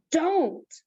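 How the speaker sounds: sharp command. Acted. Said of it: "the way he said it made Jessie stop".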